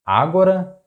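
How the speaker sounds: Agora with the first syllable punched high, AH-go-ra; stress on first syllable. Shown as a demonstration. Teacher offering learners a possible pronunciation, Agora stressed on the first syllable. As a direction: wrongly stress the opening A of Agora, pitched high. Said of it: "How do you pronounce it?".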